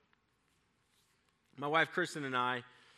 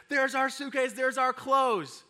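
put-on excited kids discovering; 9 semitones higher, 5 dB louder than usual. Kids spotting their luggage.

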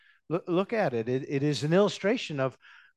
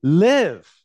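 slow build to a loud live; congregation call; 8 dB louder than usual. Real, not acted. Preacher getting the congregation to say live, loudly. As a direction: draw out yet shall he then call live out loud.

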